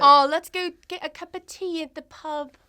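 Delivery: loud, high put-on London accent. Shown as a demonstration. Guest tries London accent again for host, exaggerated London accent. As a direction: push the fake London accent harder. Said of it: "your best London accent".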